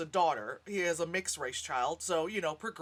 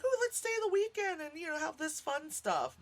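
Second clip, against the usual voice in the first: mock excited guest; 8 semitones higher than usual. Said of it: "to be like".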